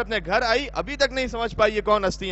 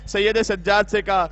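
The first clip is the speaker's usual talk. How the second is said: ordinary talk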